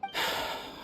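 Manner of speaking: soft unvoiced tired sigh; weary sigh. Knight feeling down sighs wearily to the travelers. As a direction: let out a tired breath.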